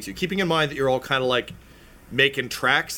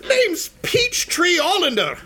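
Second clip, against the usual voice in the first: gnome introduction; 5 dB louder than usual. Acted. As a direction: announce your name like a perky little gnome. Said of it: "she says".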